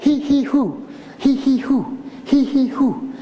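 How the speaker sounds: short huffs then a long blow; breathing exercise. Shown as a demonstration. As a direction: puff it out like labor breathing. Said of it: "Do you do that?".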